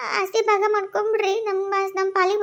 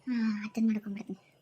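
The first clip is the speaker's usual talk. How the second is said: fast conspiratorial whisper; 10 semitones lower, 11 dB quieter, faster than usual. Acted. Wife whispers her secret plan to herself. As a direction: breathe it out secretly, quickly.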